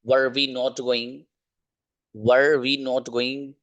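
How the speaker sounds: each word drawn out slowly; slow careful correction. Shown as a demonstration.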